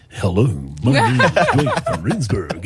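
slow, exaggerated radio-host parody; mock your own on-air voice, drawn out; put-on presenter voice. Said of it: "are you going to change your voice".

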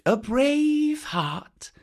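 a hint of praise; admiring python. A narrator's reading. Kaa speaking.

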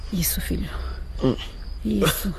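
put-on whispered soothing to the sick boy. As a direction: hush him gently, barely voiced. Mother soothes sick son. (sussurro carinhoso)

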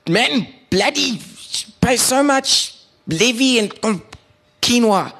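high, slow, halting complainer caricature; put-on high voice, grumbling word by word; George. George grumbles to the neighbourhood group about levies, high halting mock voice. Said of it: "read this in the voices that I believe".

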